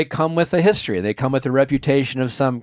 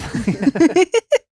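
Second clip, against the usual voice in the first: delighted squeal of a laugh; high burst of laughter. Real, not acted.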